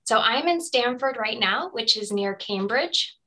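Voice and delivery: usual speaking voice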